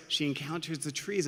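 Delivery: usual speaking voice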